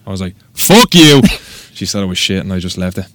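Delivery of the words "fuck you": shouted retort, higher and louder; mock shout. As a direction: yell it back at her. Retold defiant retort to judge.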